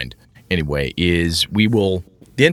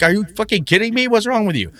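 exasperated disbelief; 11 semitones higher than usual. Retold or imitated. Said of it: "You're like".

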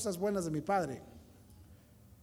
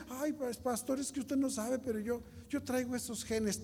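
imitando al hermano quejoso; 4 semitones higher, 4 dB quieter than usual. Retold or imitated. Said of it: "¿qué dicen?".

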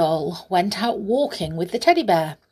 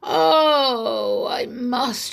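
long, loud, high yawned "Oh"; yawning; 6 semitones higher, 4 dB louder, much slower than usual. A narrator's reading. Bear settles under the tree for a rest, yawning. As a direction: yawn through the word, sliding down. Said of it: "and yawned".

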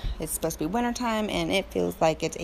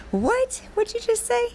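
very high-pitched squeaky double-take; hammed-up incredulous squeal; shocked disbelief. Mother retelling her shock at son's remark, high incredulous squeal.

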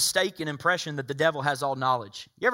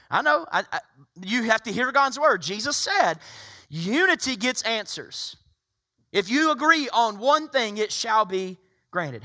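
raised pitch, slowing on the promise; insistent preaching; 7 semitones higher, 6 dB louder, slower than usual. Real, not acted. Pastor telling doubters to hear God's word, insistent preaching. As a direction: press the Scripture home, high and deliberate.

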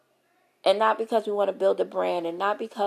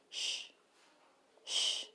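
soft drawn-out shh; shushing; 13 dB quieter than usual. Shown as a demonstration. Telling gossipers to hush, a long soft shh. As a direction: hush them like a librarian. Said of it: "shushing critics".

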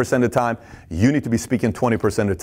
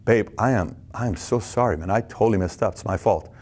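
soft, low sincere apology; soft remorseful partner owning the mistake; apologetic; 5 semitones lower, 3 dB quieter than usual. Retold or imitated. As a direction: apologize gently and quietly. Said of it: "That person says this".

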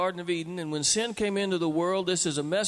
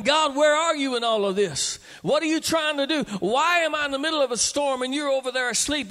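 higher, imitating an anguished believer; pleading prayer; 7 semitones higher, 4 dB louder than usual. A believer asks God why He sleeps, pleading. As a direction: cry out to God as if abandoned.

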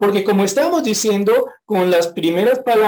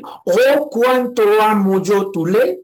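heartfelt exclamation; 2 semitones higher, slower than usual. Shown as a demonstration. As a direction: say it from the heart, fully meant. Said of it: "sinceramente hablando, puede decir".

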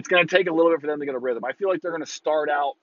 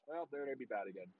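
sudden flat deflated drop, much softer; deadpan; 2 semitones lower, 20 dB quieter than usual. Real, not acted. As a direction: let all the air out. Deflated answer after cheer.

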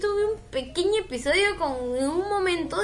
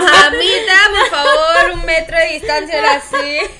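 loud, playful scolding; mock warning; 2 semitones higher, 11 dB louder than usual. Telling her friend to keep her distance, loud mock scold.

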